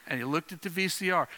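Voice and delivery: ordinary talk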